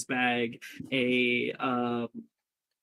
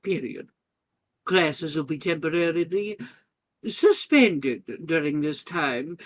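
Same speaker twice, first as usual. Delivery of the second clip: lightly voiced headmistress, a bit louder; 4 semitones higher, 6 dB louder than usual. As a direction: make the announcement as a mildly formal headmistress.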